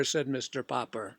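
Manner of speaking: ordinary delivery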